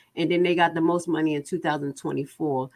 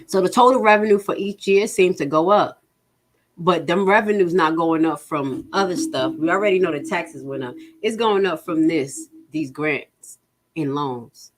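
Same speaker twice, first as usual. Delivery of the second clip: ordinary talk, nothing marked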